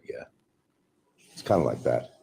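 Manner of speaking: ordinary talk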